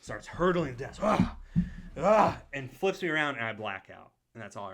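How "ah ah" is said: high, loud "ah" bursts; two loud yelps of leaping effort; effortful mock cries. Teacher hurdling desks to save a student, effortful cries.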